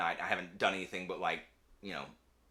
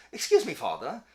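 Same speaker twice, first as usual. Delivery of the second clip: lightly voiced polite British child; 5 semitones higher, 4 dB louder than usual. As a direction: politely ask in an English child voice. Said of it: "Why are they British?".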